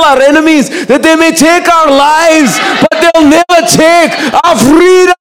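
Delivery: mock-heroic Scottish battle speech, high and slow; hammed-up Scottish warrior oration; Braveheart. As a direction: build a Scottish rallying cry into a long, drawn-out final shout. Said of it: "he became Braveheart".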